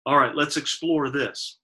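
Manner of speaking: normal talking voice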